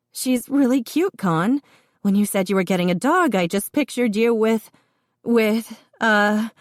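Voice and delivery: a pregnant sister trying not to laugh; amused sister. A narrator's reading. Colleen voiced laughing.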